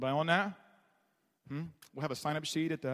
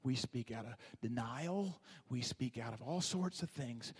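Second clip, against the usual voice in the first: quiet listing; 5 semitones lower, 7 dB quieter than usual. Real, not acted. Preacher lists, voice drops low.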